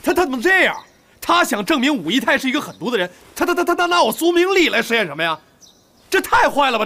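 high, agitated, stammering indignation; worked-up disbelief, tripping over words; outraged. Acted. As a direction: burst out in high-pitched outrage. Outraged on learning the scheme.

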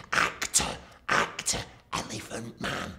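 silently mouthed words. Retold or imitated. Producer mouthing to the presenter over the camera, mouthed. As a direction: mouth it across the room without voice. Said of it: "Trevor mouths over the camera".

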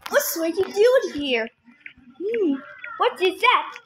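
ordinary delivery

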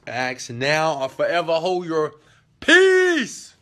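drawn out slowly, then PEACE soars way up and loud; mock wedding call. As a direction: ham up the wedding line, belting the last word. Mock solemn wedding line, peace sung high.

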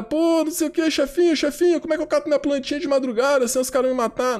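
high, quick mock complaint; imitated follower fretting to the boss; whiny underlings.

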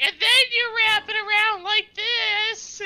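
very high, loud squeaky voice; cheerful squeak while wrapping; Rupert's voice. Acted. Rupert wrapping the patient's leg, high squeak. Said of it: "you just like".

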